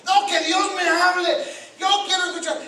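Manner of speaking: high, very loud mock protest; demanding churchgoer. Preacher mimicking members who ignore the pastor, mock insistent shouting. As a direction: stretch out a stubborn believer's loud demand.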